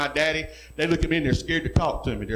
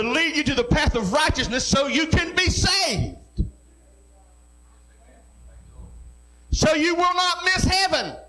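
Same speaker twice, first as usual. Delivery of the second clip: full-force preaching; 7 semitones higher than usual. Acted. Pastor exhorts his congregation to hold forth the word, preaching at full force. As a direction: build the sermon to a shouting climax.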